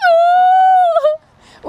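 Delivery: high-pitched hammed-up panic; mock freaking out. Storyteller imitating the terrified army, high mock wail. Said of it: "They're freaking out".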